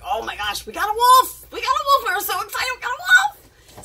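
squealing high with excitement; excited kids. Retold or imitated. Girls thrilled to have found a wolf, squealing excitedly.

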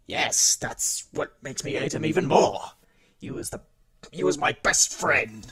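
loud, high-pitched cry of betrayal; impassioned shout of a betrayed friend; hurt, angry outburst. Acted. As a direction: burst out, raising voice and pitch. Hurt outburst about former best friend.

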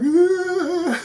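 high, strained ugh of being overwhelmed; high teary groan; emotional groan. Retold or imitated. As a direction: let out a choked-up ugh. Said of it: "And I was like".

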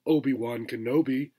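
usual speaking voice

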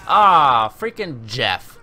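loud groan then slow drawn-out name; annoyed groan. Real, not acted. Gamer groaning at the enemy player, slow and loud. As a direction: drag the name out, fed up.

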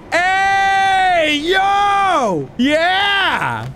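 long high whooping shouts; high-pitched party hollering; hyped cheering. Real, not acted. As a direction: whoop it out, every word stretched. Hyped celebration for James Charles Chango.

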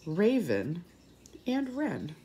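usual speaking voice

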